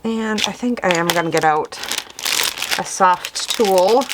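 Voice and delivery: muffled through a mask. Real, not acted. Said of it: "I'm sorry if I sound muffled, I do have a mask on".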